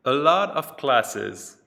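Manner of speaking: A lot of classes, slow with lot stressed; slow and loud. Shown as a demonstration. Teacher reading the vocabulary item, slow and emphatic. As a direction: lean on lot and slow right down. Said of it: "teacher reads vocabulary item".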